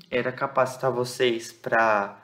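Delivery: ordinary delivery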